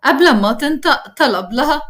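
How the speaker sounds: ordinary delivery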